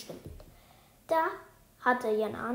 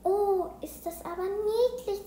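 high, drawn-out gush; delighted cooing; 9 semitones higher, 5 dB louder, slower than usual. A narrator's reading. Luise seeing the guinea pig, delighted cooing. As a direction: coo slowly over something cute, pitch well up. Classmate coos over pet.

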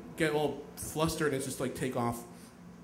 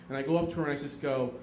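normal talking voice

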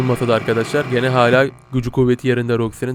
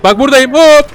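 high, fast, teasing calls; excited taunting; 8 semitones higher, 9 dB louder than usual. Real, not acted. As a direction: tease the monster, rising loud. Taunting a chasing monster.

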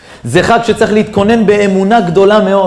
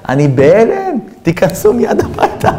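tearful, higher-pitched welcome; acted tearful; 5 semitones higher, slower than usual. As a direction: voice breaking upward as if crying. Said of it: "הוא התחיל לבכות".

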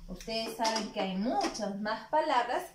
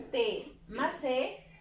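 slow sounding out; much slower than usual. Shown as a demonstration. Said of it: "teacher sounding out syllables".

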